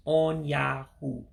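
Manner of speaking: On Yahoo very slow, three clear syllables; slow model of On Yahoo. Shown as a demonstration. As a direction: spell out On Yahoo slowly for learners. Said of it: "So three syllables".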